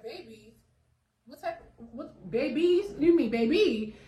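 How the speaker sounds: rising to a loud stunned cry of babies; half-shouting in sheer shock; shocked disbelief. Retold or imitated. Teen mom hearing twins from technician, loud shocked disbelief.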